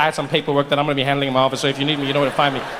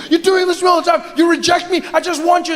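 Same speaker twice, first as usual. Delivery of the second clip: whiny man; 12 semitones higher than usual. Acted. Boyfriend complaining his partner rejects him, high whine.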